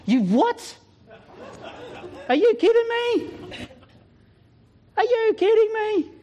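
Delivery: high, stunned disbelief, drawn out slowly; incredulous. Retold or imitated. Preacher stunned by God's simple word, high incredulous squeak. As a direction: squeak out are you kidding me in shock.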